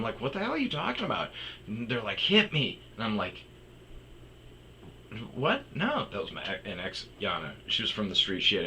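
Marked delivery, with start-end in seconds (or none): 0.2-1.3 high, fast, incredulous comeback; re-enacted bewildered protest; acted disbelief. His baffled reply, retold. 2.2-2.8 high, loud imitated dare; re-enacted provocation; mock daring. Retold dare from girlfriends. 5.3-6.1 very high, startled refusal; re-enacted appalled squeak; acted shock. His shocked refusal, retold.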